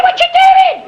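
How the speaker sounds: high, loud outraged yell; furious householder shout; indignant shout. Retold or imitated. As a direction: yell it at the man in the hall. Shouting at the soot-tipping salesman.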